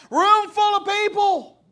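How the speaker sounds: high, drawn-out holler; loud, stretched emphasis; loud exclamation. Real, not acted. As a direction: call it out slowly at full volume, pitch held high. Stressing where the wife yelled.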